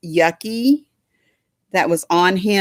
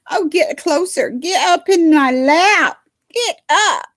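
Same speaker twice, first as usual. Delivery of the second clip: coaxing pet talk; 10 semitones higher, 4 dB louder than usual. Real, not acted. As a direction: coax him up into your lap. Calling dog onto her lap.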